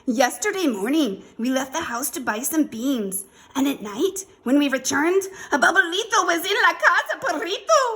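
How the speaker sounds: high, loud, urgent storytelling; excited little chihuahua. A narrator's reading. Poquito Tito reporting the Babalito, excitedly loud.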